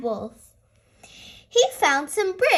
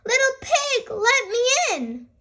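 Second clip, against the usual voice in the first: wolf calling; 8 semitones higher than usual. Acted.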